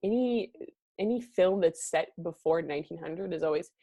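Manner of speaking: normal talking voice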